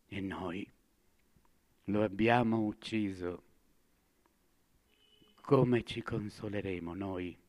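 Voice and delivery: soft and low; hushed confession. Acted. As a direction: confess it in a near murmur. Madman's quiet confession.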